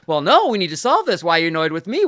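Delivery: hammed-up defensive husband; husband arguing back at his wife. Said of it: "all this defensive".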